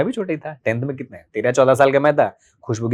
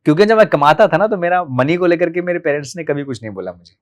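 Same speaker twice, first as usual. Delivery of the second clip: ordinary talk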